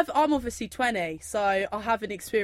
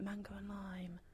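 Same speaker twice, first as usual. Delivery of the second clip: barely audible whispered order; hushed, sheepish whisper; embarrassed whisper; 19 dB quieter than usual. Retold or imitated. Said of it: "like that".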